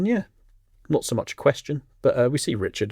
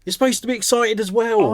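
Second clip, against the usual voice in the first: excited; 12 semitones higher, 4 dB louder than usual. Real, not acted. Urging co-host to be excited.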